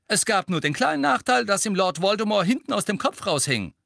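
high, loud sarcastic outburst. A narrator's reading. Harry taunts his teacher before a stunned class, loudly. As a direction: blurt it out at full voice. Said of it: "sagte Harry laut".

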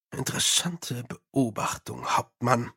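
softly musing, low-key; soft, reflective remark; thoughtful. A narrator's reading. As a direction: say it quietly, as if pondering. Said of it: "sagte Mumm nachdenklich".

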